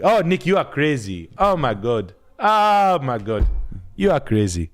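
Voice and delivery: a Nigerian priest drawling Jesus Lord, hammed up; Nigerian teacher impression. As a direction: act out his old teacher scolding him with wonder. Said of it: "He was like".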